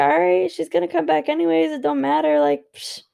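smug shrug of a voice, dropping down by the end; mocking careless partner. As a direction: play the partner who knows she'll come back. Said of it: "they're gonna be like".

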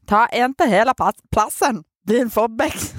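hitaasti skånen murteella; keksitty Skåne-aksentti, verkkaisesti; skone accent. Shown as a demonstration. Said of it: "kaikki on skone". (hidas skoonemurre)

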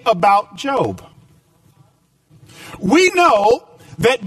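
rising preacher's push, building through each line; preaching. Acted. Pastor preaching to his church, emphatic and driving. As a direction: preach it out to the congregation with conviction.

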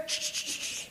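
soft, voiceless shushes; repeated shushing. Acted. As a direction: shush someone again and again. Acted silencing of an objector.

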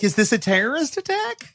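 high mock alarm, hammed-up panic. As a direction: act like the school calls are a terror attack. Mocking urgent school calls.

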